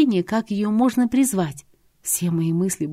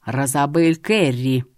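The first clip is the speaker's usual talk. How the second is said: low, slow growl of the name; displeased growl; 4 semitones lower, slower than usual. Acted. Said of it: "недовольно прорычал".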